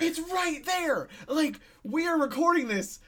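excited. Real, not acted. Realizing base is right nearby.

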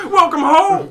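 high, loud, laughing sing-song welcome; welcoming someone home. Pretend. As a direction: beam it out like a proud landlady. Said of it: "my share impression gets worse".

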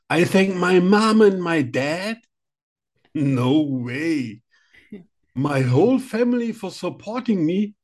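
high, questioning acceptance-speech thanks; hammed-up award winner reading out thanks in English; mock Oscar speech. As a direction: play a doubtful Oscar winner. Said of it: "das ist meine erste Oscar-Rede".

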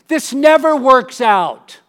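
crowd that saw it coming; knowing crowd. Retold or imitated. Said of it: "audience is going".

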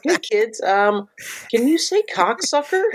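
put-on very high, sing-song children's presenter; mock kids' host. Friend parodying a children's show host, high sing-song voice.

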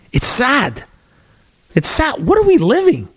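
rising to a high, exasperated cry; exasperated disbelief. Real, not acted. Vlogger despairing over the news, rising high in disbelief.

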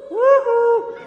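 very high, loud woo-hoo; exuberant whoop. Retold or imitated. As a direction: read it as a full-out whoop. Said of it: "It says".

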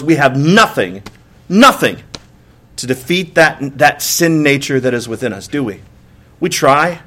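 ordinary talk

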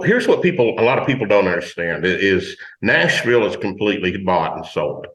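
normal talking voice

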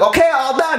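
very high masseuse sing-out; imitated masseuse. Masseuse dismissing aroused client, high imitated voice. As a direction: send it way up, imitating her. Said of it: "She's like".

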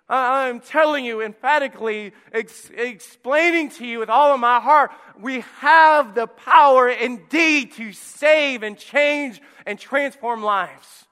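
high, loud preaching shout; full-force preaching. Acted. Preacher shouting the truth at his church, loud and high. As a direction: drive it home to the congregation.

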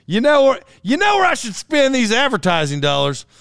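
high, hammed-up bright idea; mock excitement. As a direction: act out a business owner's sudden brainwave. Plays would-be advertiser getting excited.

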